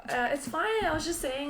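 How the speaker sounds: high-pitched, placating self-quote; replaying a light brush-off; retold reassurance. Replays reassuring the upset friend.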